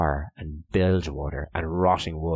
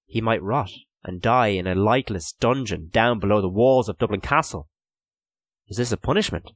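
ordinary delivery

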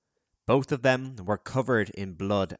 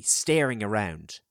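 usual speaking voice

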